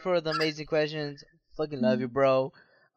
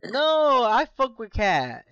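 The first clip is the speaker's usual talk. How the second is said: loud; 7 semitones higher, 5 dB louder, slower than usual. Real, not acted. Defends friend loudly.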